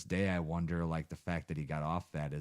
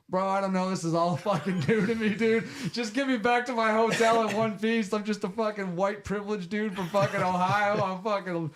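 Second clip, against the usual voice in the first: much higher and louder, squeaky self-mocking panic with a laugh; mock panicky whine; 8 dB louder than usual. Scared buyer begs the dealer to take him back to the hotel, high panicked whine. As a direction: play your younger self freaking out, voice cracking up high.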